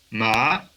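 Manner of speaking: ma gliding upward; second tone. Shown as a demonstration. Teacher going through the tones, ascending ma. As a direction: raise MA like a question.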